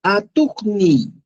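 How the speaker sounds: Atukni, said slowly; teacher modelling Atukni; Quechua word. Shown as a demonstration. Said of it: "Y recién le agrego la Y".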